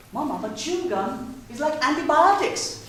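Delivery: hint of a clever little girl; child voice.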